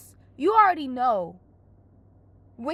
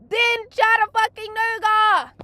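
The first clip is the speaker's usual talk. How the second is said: incredulous; 8 semitones higher, 8 dB louder, much slower than usual. Real, not acted. Incredulous rant about airport.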